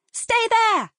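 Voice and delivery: high, loud frantic yell across the road. Acted. Girl yells to sister across busy traffic, high frantic shout. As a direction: shout to stop her little sister. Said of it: "Adele yelled out".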